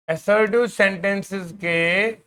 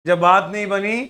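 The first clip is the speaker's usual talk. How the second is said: declaimed verse with drawn-out, punched words; poetry recitation; 4 dB louder than usual. Acted. Teacher reading a student's couplet to the class, declaimed. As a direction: recite it in slow, weighty phrases with pauses.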